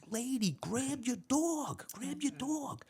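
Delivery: high, slow, desperate mock plea; panicked yelling. Cop retells yelling at the lady as the dog closes in, high and panicked. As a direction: beg her in rising terror. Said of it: "And I'm yelling".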